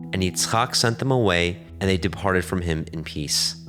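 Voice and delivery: ordinary talk, nothing marked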